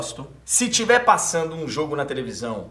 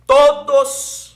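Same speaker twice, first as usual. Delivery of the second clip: much higher, loud projected call; booming loud; 12 semitones higher, 12 dB louder than usual. Real, not acted. Narrator proving his point to the hosts, booming loud. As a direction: belt it out at full force. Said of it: "Peraí que o retorno aqui bateu".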